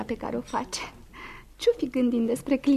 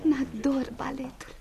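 soft, slow breathless whisper; dreamy whisper; 7 dB quieter, slower than usual. Acted. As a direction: whisper it as if still under the music's spell. Enchanted after the ballet. (șoaptă visătoare, lentă)

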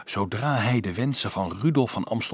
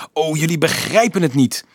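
high, loud exasperated outburst; foot-stamping frustration; 6 semitones higher, 5 dB louder than usual. A narrator's reading. Dolf rejects the monk's comfort, angrily frustrated. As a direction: burst out, fed up with them. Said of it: "zei Dolf stampvoetend".

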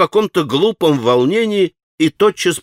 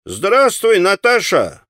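calling out to a market woman; cheeky shouted greeting; a boy hollering across the stalls; 8 semitones higher, slower than usual. A narrator's reading. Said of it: "крикнул он".

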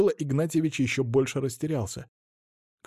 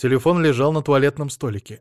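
plain reading voice

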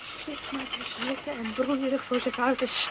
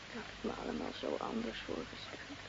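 almost unvoiced, much softer; disappointed whisper; 14 semitones lower, 12 dB quieter than usual. Wife whispering her broken expectations. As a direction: let the hope drain out of it.

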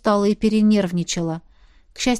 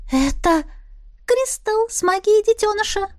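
much higher, excited sprite voice; excited fairy voice; 12 semitones higher than usual. Acted. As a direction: squeal it like a tiny fairy. Искра восклицает. (восторженный высокий голосок)

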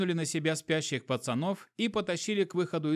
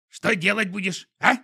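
high, loud creature voice; squeaky animal sidekick; skunk voice; 5 semitones higher, 6 dB louder than usual. A narrator's reading. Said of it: "спросил скунс".

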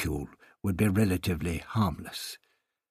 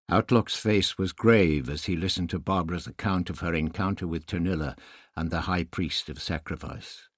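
ordinary delivery, nothing marked

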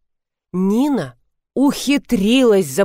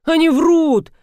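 high-pitched stubborn protest; indignant high insistence; insistent; 8 semitones higher, 4 dB louder, slower than usual. A narrator's reading. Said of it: "настаивал на своём Ефим".

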